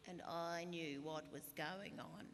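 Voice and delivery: lightly voiced politician impression, slow drawn-out vowels; a hint of a slow, deliberate politician; Julia Gillard. Comedian showing off her prime minister impression, slow drawl.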